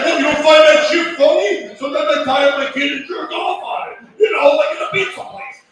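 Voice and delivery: mock bragging shout. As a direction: yell it like a proud creep at a bar. Comic acts out crude male confessor.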